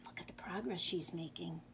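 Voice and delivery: soft, low whisper; whispered aside. Acted. Reader showing children the spider's progress, whispered. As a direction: whisper it so as not to disturb.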